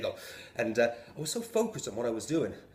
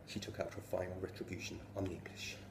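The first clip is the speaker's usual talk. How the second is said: ordinary talk, nothing marked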